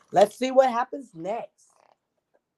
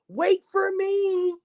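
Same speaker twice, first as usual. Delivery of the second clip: much higher, slow plea to wait; calling after him; 11 semitones higher, slower than usual. Acted. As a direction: stretch it out as you chase him. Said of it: "he called".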